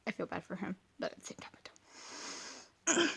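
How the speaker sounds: soft, unvoiced whisper; whispered confession. Confessing to viewers about the dog, whispered. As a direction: whisper it like a guilty secret.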